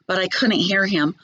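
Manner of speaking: ordinary delivery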